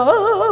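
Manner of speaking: mock wailing sobs; imitated sobbing. Retelling their tearful prayer at church, imitated sobbing. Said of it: "울고 있었어".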